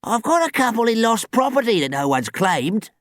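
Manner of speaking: put-on high, loud old man's voice; character voice, helpful old man; old park keeper. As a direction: suggest it as an elderly caretaker. Plays elderly superintendent.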